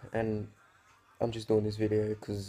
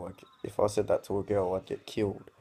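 ordinary talk

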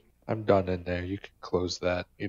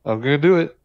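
laughing; 6 semitones higher, 9 dB louder, faster than usual. Real, not acted. Laughing gamer psyching himself up.

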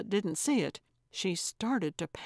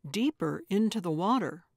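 usual speaking voice

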